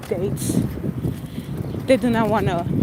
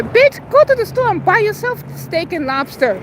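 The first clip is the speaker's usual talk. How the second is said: scornful retort; 8 semitones higher than usual. Real, not acted. Scolding the imagined women.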